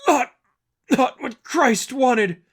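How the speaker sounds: slow, high, strained plea; broken pleading. Acted. Battered seraphim pleading with Angel, slow and high. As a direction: force the words out slowly through pain.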